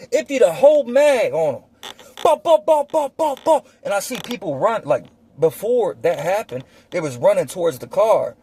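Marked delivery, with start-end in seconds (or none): 2.2-3.6 loud high shouted bah bah gunshots; imitated gunfire. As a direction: spit out each shot like a pistol firing. Emptying the mag, imitated shots.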